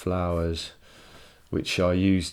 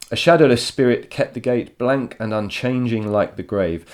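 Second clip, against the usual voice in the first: louder, higher reading of the poem line; measured recital of a poem; 5 semitones higher, 6 dB louder than usual. Acted. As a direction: read it out as verse for the class. Reads poem lines aloud.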